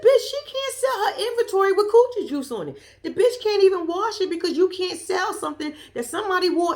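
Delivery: high, loud, laughing disbelief; outraged laughing rant. Real, not acted. Vlogger retelling her reply about worn inventory, laughing outrage. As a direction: howl it in shocked laughter.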